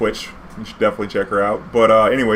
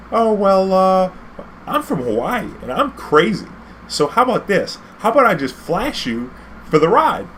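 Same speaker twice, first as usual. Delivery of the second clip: higher, coy put-on girl voice; 9 semitones higher than usual. Tourist girl bargains with cab driver, coy put-on higher voice. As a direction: play the coy tourist offering a flash. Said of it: "she was like".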